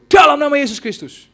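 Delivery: loud, high rebuke in Jesus' name; commanding shout. Retold or imitated. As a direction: cast the spirit out with force. Commanding deliverance prayer. (bentakan keras mengusir setan)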